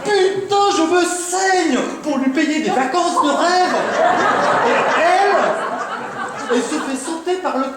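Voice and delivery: high-pitched, indignant tirade; outraged, stretching out the bitterest words; worked-up outrage. Acted. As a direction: rant like a betrayed husband, voice climbing. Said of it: "te mettre dans des états pareils".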